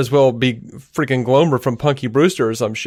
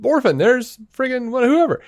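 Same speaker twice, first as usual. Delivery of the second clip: high-pitched imitation of a thrilled fan; mock excited fan; 10 semitones higher than usual. Imitating comic book fans spotting a cameo, mock excited. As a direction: gush like a starstruck fan, mockingly.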